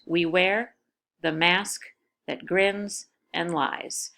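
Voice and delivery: we wear, the mask, that grins, and lies, each pair split off with a short pause and the second word stressed; scanning the feet. Shown as a demonstration. Teacher showing students the iambs of the line, word pairs set apart. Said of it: "there are four metrical feet".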